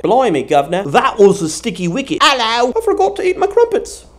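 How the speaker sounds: mock British, over the top; British accent. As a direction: put on a silly British voice. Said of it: "throw in a little bit of British".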